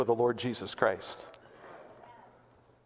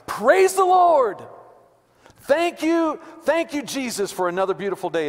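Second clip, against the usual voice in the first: joyful shout; 9 semitones higher, 4 dB louder than usual. Retold or imitated. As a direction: call out to the morning like an old church mother. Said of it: "She would greet each new day by shouting".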